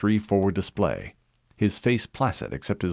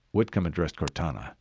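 plain reading voice

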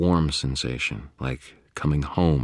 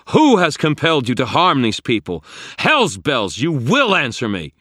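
high, loud compulsion; commanding shout; 11 semitones higher, 9 dB louder than usual. A narrator's reading. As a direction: force an answer with your will. Wizard forces monster to answer.

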